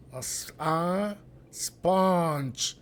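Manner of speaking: slow "A sponge" with a clear stressed schwa in "sponge". Shown as a demonstration. Teacher to Spanish-speaking students, slow careful demo. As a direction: drag out "A sponge" and lean on the schwa. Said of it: "Es un schwa y un schwa estresado".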